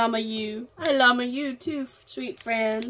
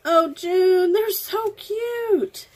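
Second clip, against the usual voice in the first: delighted squeal; 7 semitones higher, 3 dB louder, much slower than usual. Real, not acted. Delighted by June's cards.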